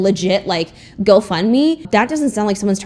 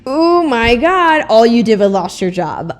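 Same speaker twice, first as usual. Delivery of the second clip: mocking imitation; 3 semitones higher, 5 dB louder, slower than usual. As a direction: parrot his sneer back at him. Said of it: "mocking James Charles' words".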